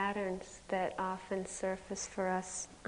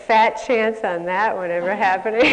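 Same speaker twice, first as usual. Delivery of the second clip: louder, laughing take on the cynical inner voice; 12 dB louder than usual. Retold or imitated. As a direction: scoff through a laugh like the cynical voice. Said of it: "this voice would say".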